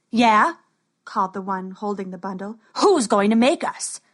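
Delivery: loud jeering thug; dim henchman. Acted. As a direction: challenge him like a bully. Bully taunts Max.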